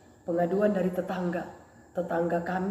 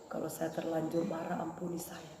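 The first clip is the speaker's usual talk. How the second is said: hushed, breathy plea for forgiveness; whispered repentant prayer; 5 semitones lower, 14 dB quieter than usual. Acted. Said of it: "Langsung saya".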